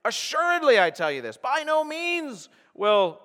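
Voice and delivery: "Assuredly" and "By no means" high and loud; emphatic declaration. Acted. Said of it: "you couldn't get more emphatic".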